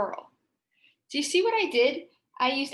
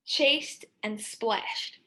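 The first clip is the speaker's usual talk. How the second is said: chased and splashed drawn out slowly; slow emphasis; much slower than usual. Shown as a demonstration. Teacher reviewing action words for the class, slow. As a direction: savour each action word. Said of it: "were some more action words".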